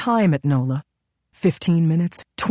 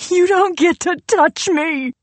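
high, loud howl of rage; 14 semitones higher, 6 dB louder than usual. A narrator's reading. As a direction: howl it at him, furious. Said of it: "she howled".

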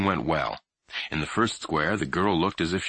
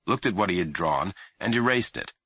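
usual speaking voice

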